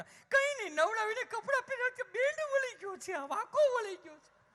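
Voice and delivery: mock a frantic city mother; panicked mother. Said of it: "મેં". (ગભરાયેલી મમ્મીની ઊંચા અવાજે નકલ)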